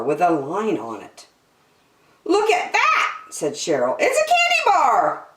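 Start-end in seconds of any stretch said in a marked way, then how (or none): 2.3-3.2 very high squeal of discovery; excited little girl. Acted. Girl spots candy bar. 4.0-5.3 very high-pitched squeal; excited little girl. Acted. Girl spots candy bar.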